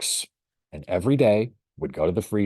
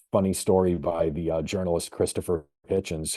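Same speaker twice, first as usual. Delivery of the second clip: ordinary talk, nothing marked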